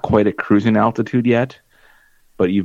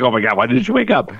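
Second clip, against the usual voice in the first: mock panic; 3 dB louder, faster than usual. Retelling panicked thought about baby.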